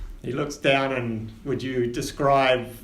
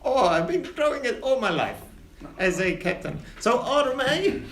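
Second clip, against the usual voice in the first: higher, pompous captain; captain Winters; 6 semitones higher than usual. Acted. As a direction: hold court with the passengers. Captain chats with Oren.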